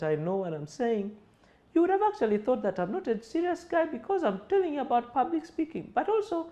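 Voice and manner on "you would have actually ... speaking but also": high, thin, unconvincing voice; unsure weak voice. Shown as a demonstration. Speaker showing students a weak, unserious tone, high and thin. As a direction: sound like someone who doesn't know their stuff. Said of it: "speaking to you in a way that doesn't really prove".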